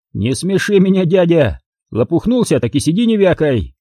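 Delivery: put-on high, sneering young thug voice; mocking thug. Thug sneers at the beaten narrator in a high mocking voice. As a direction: jeer down at the old man on the ground.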